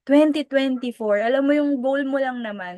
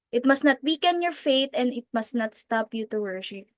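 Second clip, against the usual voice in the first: normal talking voice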